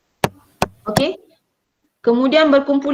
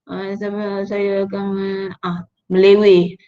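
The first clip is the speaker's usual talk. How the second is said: mumbled, unclear speech; 3 semitones lower, slower than usual. Shown as a demonstration. Teacher showing students how a mumbled answer sounds. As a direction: mumble "saya, saya akan" so it can't be heard. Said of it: "tak dengar, tak jelas".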